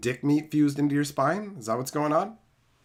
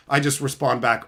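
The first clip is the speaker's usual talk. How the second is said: ordinary delivery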